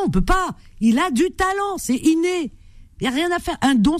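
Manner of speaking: high, eager admiration; excited praise. Real, not acted. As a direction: gush about his gift. Host raves about caller's talent. (enthousiasme aigu et admiratif)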